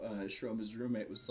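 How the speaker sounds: ordinary delivery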